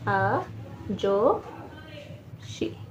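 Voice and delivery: आजोशी very slow and soft, a, jo, shi; long pauses between the syllables of आजोशी; slow dictation. Shown as a demonstration. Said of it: "तो लिखते हैं हम लोग".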